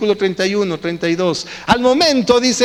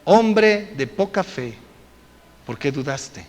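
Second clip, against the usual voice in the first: gentle rebuke; 5 semitones lower, 3 dB quieter, slower than usual. Acted. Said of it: "Y le dijo".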